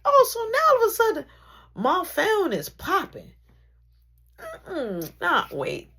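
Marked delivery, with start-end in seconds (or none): 0.1-3.3 fast, loud and high burst; mock outrage. Mock shock that the phone is suddenly popping. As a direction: blurt it out in fake shock.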